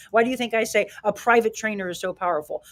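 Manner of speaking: normal talking voice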